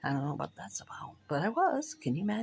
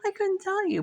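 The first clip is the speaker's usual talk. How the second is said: high-pitched breathy giggle over the words; giggling; slower than usual. Real, not acted. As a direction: laugh it off, squeaking high. Giggling at own joke.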